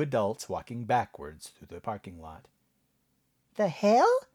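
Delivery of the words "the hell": squeaky high-pitched voice; surprised, pitched way up. Acted. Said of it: "in a surprisingly high-pitched voice".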